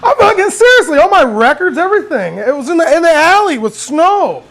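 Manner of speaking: worked up all over again; getting mad. Real, not acted. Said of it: "now I'm starting to get mad".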